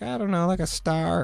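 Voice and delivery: put-on airy teenage shrug of an answer; daughter's voice. Dad imitating his daughter, lightly voiced teen girl.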